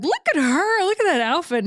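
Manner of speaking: high-pitched excited admiration; admiring gush. Retold or imitated. Host pointing out a friend to her husband, admiring gush. Gushing over friend across the set.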